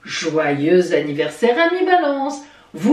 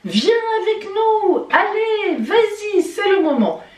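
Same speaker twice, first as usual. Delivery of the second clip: high-pitched rallying cry; appel enjoué; 13 semitones higher than usual. Acted. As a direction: call them along eagerly, pitched very high. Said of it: "elle va vous dire". (appel entraînant, voix très aiguë)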